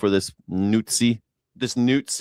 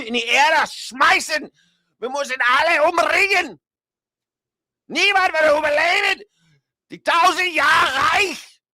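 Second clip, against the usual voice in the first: put-on high, shouted German accent rant; 3 dB louder than usual. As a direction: bellow it like a rally speech in a German accent. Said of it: "in a German accent".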